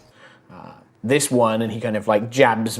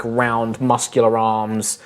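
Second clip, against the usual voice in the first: ordinary talk, nothing marked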